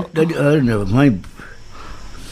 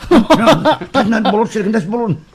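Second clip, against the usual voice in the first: laughing; 9 semitones higher, slower than usual. Real, not acted. Joke about bullets and balloons.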